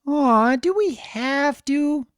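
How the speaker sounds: high, slow whining foal voice; whiny colt. Acted. Foal yelling back to his parents, high and whiny. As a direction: drag it out like a reluctant kid. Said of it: "Flit yelled back".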